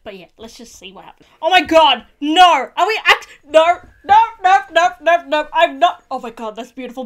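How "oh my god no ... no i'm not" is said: loud, repeated refusals in shock; real loud protest. Real, not acted. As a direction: fire off loud, disbelieving protests. Distressed reaction to the show.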